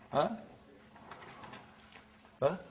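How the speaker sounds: normal talking voice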